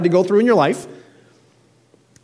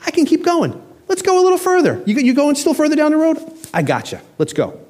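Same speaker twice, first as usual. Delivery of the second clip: much higher, bright willing offer; cheerful; 11 semitones higher than usual. Retold or imitated. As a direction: sound eager to keep walking. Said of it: "you cheerfully saying".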